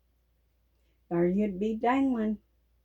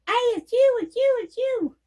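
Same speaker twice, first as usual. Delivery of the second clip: much higher, loud excited holler; mom hollering; 12 semitones higher, 4 dB louder than usual. Retold or imitated. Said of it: "Mom would holler".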